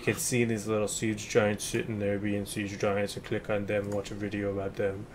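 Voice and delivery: lightly voiced siege giant; a hint of a cute, lumbering giant. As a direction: slip into a slight siege giant voice. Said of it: "is that the siege giant voice?".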